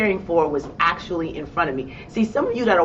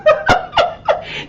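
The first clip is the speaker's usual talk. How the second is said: high burst of laughter; 5 dB louder than usual. Real, not acted. Laughing at her own boldness.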